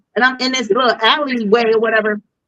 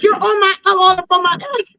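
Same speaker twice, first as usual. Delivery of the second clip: high-pitched indignant complaint; retold aggressive retort; 10 semitones higher than usual. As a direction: fire back fast in a high voice. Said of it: "I'm in aggressive mode".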